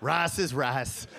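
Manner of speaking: lightly voiced low dad; low, dismissive dad; dad's voice. Dad brushing off his kid's correction, low and dismissive. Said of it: "He's like".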